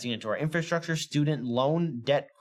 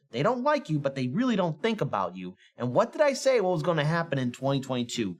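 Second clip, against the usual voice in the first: usual speaking voice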